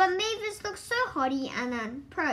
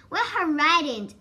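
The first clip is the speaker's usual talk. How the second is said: ordinary delivery, nothing marked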